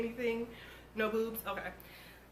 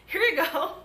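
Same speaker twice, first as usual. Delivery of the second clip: mock cheerful handover; 6 semitones higher, 5 dB louder than usual. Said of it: "They was just like".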